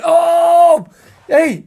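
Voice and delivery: a loud, high, tipsy whoop; drunk delighted shout. Retold or imitated. Drunk coach whooping at the driver's answer, loud and high. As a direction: cheer like a drunk coach who just heard great news.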